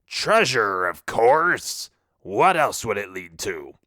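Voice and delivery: a hint of a know-it-all sea dog; pirate scoffing. A narrator's reading. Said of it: "A map to what?".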